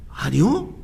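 normal talking voice

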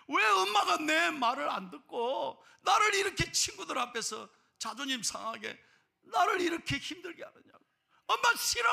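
loud, high outburst of hurt and anger; upset daughter. Acted. Daughter upset at mother before her friends, shouting angrily. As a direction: cry out as a wounded child fed up with mum. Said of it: "너무너무 엄마가 속상한 거예요".

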